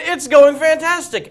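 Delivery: high, loud, slow mock delight; playing along. Man answers the star's staged greeting, playing along. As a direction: gush back, stretching it out.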